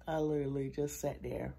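usual speaking voice

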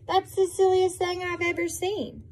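high put-on voice, scoffing; storybook cow, amused disbelief; cow's thought voice.

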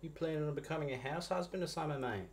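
high, teasing Australian mate; very Aussie accent. Pretend. Coworker teasing about becoming house husband, Aussie accent. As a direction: rib a workmate in broad Aussie. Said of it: "say it with an Aussie accent".

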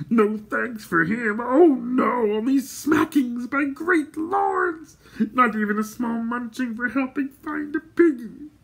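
high, slow wailing creature voice; Gurgi's howling complaint. A narrator's reading. Gurgi complaining to great lords, howling. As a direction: wail the grievance in a high creature voice. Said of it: "howled the creature".

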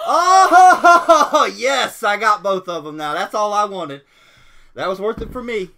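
loud, high whoop breaking into laughter; thrilled, laughing shout; real jubilant outburst. Real, not acted. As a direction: celebrate a lucky win at full volume. Wins both wanted characters.

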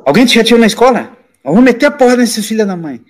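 high-pitched mock indignation; protective outrage, retold. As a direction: bristle on a youngster's behalf. Protective older men threatening a bully.

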